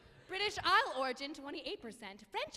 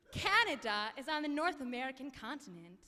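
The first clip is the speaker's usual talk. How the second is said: usual speaking voice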